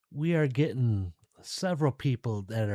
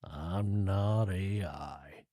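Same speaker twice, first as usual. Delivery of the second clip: slow, lower mock grump voice, not very grumpy; a half-hearted grouchy puppet growl; Oscar the Grouch; 3 semitones lower, 4 dB quieter, much slower than usual. Podcaster joking he's not AI, mild Grouch voice. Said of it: "If I was like Oscar the Grouch".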